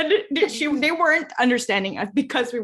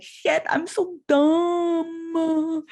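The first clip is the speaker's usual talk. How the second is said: very slow, higher, hammed-up dumb voice; mock dumb contestant; 7 semitones higher, much slower than usual. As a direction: stretch it out like a clueless quiz contestant. Imagined quiz-show answer.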